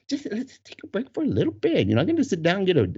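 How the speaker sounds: high, quick wife's plea; imitating pleading wife. Wife coaxing husband to sit down, mock pleading. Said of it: "She's like".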